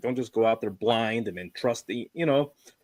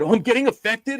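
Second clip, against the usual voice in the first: high, loud hammed-up distress; mock dramatics; 9 semitones higher, 5 dB louder than usual. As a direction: imitate a ghost hunter overacting an attack. Mocks investigator claiming to be affected.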